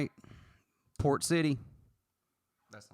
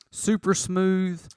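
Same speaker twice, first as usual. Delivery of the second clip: drawn out; 2 semitones higher, 7 dB louder, much slower than usual. Real, not acted. Savoring the whiskey.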